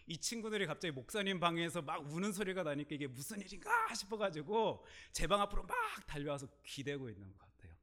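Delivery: ordinary talk, nothing marked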